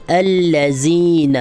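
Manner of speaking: الذین said with a z sound instead of dh; common mistake. Retold or imitated. Teacher imitating how people misread الذین. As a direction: mimic the mistaken alazin with a hard z. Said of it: "لوگ اس کو کیا پڑھ دیتے ہیں؟".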